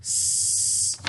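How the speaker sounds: "S." as a soft unvoiced hiss; whispered S sound. Shown as a demonstration. Teacher sounding out tricky word, soft hiss. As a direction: sound out "S." breathily. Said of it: "The first sound is S".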